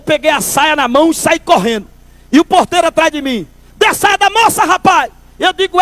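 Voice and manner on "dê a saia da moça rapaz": put-on high, pushy call after a fleeing kid; hammed-up doorman yelling; mock yell. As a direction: shout after him to give it back. Doorman chasing, demanding skirt back.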